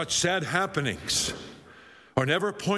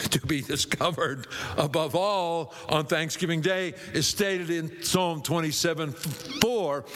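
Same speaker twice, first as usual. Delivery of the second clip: ordinary talk, nothing marked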